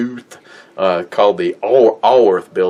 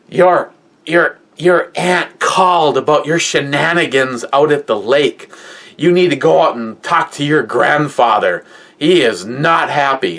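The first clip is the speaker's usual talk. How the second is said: higher, pinched scolding grandma; stern grandmother quote; 6 semitones higher than usual. Retold or imitated. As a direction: tell him off like an upset grandma. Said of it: "and she's just like".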